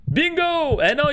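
high loud gleeful cry; excited shout. Real, not acted. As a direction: call it out triumphantly. Bingo annoncé en criant. (cri de joie)

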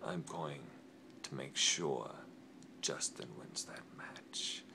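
hushed, intense whispered vow; menacing whisper. Acted. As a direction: swear it under your breath. Threatening rival in promo.

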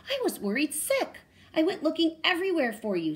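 high, loud worried mother. A narrator's reading. Mama Hyena tells Penduli she searched everywhere. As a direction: tell her how you searched.

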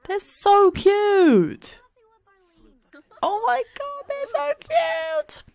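high, drawn-out cooing; squealing cute. Real, not acted. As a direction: melt over how cute they are. Gushing over the couple.

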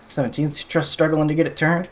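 usual speaking voice

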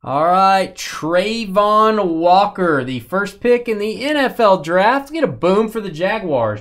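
high, loud hype call, stretching the player's name; booming, high-pitched card-break excitement; excited announcer. Real, not acted. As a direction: announce it like a draft-night reveal. Announces a big card pull.

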